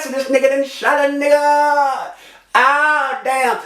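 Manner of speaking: high, long-held wail on one note; shocked cry. Retold or imitated. Shot man shows just how he cried out, shocked cry. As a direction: wail it in disbelief, drawing it out. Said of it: "just like that".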